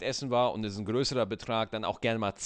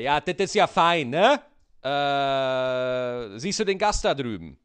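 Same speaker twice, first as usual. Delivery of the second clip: loud and higher, mock Berlin trainer; 3 semitones higher, 7 dB louder than usual. Trainer pointing the apprentice to a waiting guest, loud mock voice. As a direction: boom it out like a smug instructor. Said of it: "Und dann sagt der Auszubildende".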